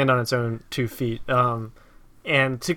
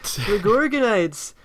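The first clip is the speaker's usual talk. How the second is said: higher, drawn-out mock fluster; lightly hammed-up flustered pitch; imitating flustered; 6 semitones higher, slower than usual. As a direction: play a flustered presenter, pitched up. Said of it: "he's, like, really flustered".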